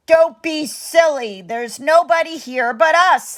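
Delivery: the giantess scolds her husband; scolding giantess. Acted. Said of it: "the giantess scolded".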